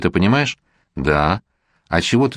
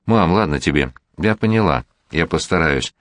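plain reading voice

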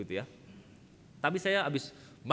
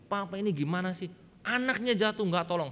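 put-on raised, louder childish reproach; retold anger at father; 6 semitones higher, 4 dB louder than usual. Son to his father who didn't help, angry. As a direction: act out a boy's indignant complaint. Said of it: "Saya marah sama papa saya".

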